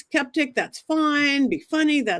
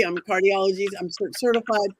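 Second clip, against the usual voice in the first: ordinary delivery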